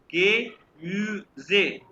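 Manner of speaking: गे म्यूजे slow, in three separate beats; syllable-by-syllable pronunciation. Shown as a demonstration. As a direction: model गे म्यूजे slowly, E as "ay". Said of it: "ये देखो ई को ए". (गे म्यूजे धीरे, तोड़-तोड़कर)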